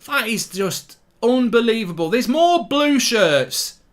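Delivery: real disbelief at the marking; exasperated yell. Real, not acted. Shouting at the Leicester defence at the corner, outraged.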